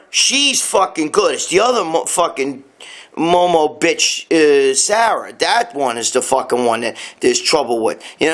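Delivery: ordinary talk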